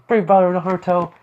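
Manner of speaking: put-on high, loud kid's call; eager kid voice. Boy gives Naruto a new title, eager high voice.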